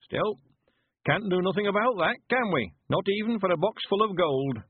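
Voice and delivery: put-on high rustic old-timer accepting a loss; high old man's dialect voice; old country man, resigned. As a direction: shrug off grief as an old villager. Narrator plays old Mister Weevil.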